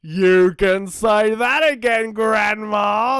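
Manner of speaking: the wolf reveals himself, yelling; yelled. Acted. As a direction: drop the disguise and shout. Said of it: "the dingo yelled".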